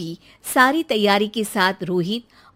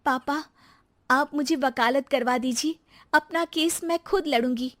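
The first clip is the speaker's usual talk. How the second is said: lightly raised voice of a hurt new bride; young wife Rekha; 4 semitones higher than usual. Acted. New bride answers her husband, a touch hurt.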